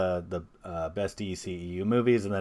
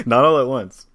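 louder, teasing dig at the guests; playful sarcastic louder quip; sarcastic jab; 4 semitones higher, 11 dB louder than usual. Real, not acted. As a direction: needle them for not answering. Host teasing slow replies.

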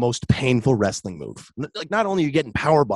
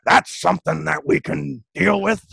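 imitating Vince; 4 semitones higher than usual. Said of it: "and he'll go".

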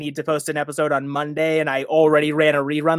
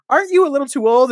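higher, fast, prim question; mock disapproving neighbor; 10 semitones higher, 3 dB louder, faster than usual. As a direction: imitate a scolding adult at the door. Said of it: "people were like".

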